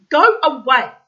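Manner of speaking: high, loud shout. A narrator's reading. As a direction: snap him away with a shout. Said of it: "shouted God".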